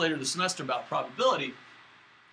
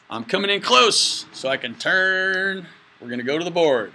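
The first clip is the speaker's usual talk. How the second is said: playful loud announcing; 2 semitones higher, 10 dB louder than usual. Pretend. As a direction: boom it out, stretching the last words. Hamming up a move toward camera.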